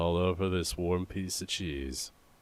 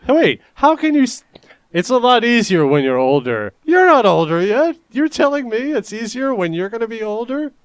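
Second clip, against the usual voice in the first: incredulous; 13 semitones higher, 10 dB louder than usual. Real, not acted. Incredulous challenge of co-host's claim.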